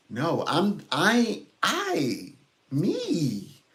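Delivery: high, punched "I, I, me"; emphatic self-assertion. Pretend. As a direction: push each word up high, hammed-up.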